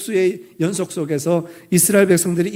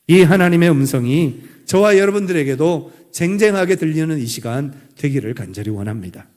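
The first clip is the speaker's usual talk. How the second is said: usual speaking voice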